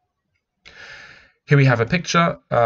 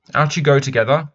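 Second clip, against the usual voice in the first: higher, louder impatient mother; a mother sending the children out, impatient; 5 semitones higher, 6 dB louder than usual. Acted. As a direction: shoo the children out the door. Mother voiced in read-aloud story.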